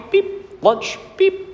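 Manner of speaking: high chirpy beep like a wristband scanner; imitated scanner beep. Preacher imitating the park scanner, high beep. Scanner sound in Disney story.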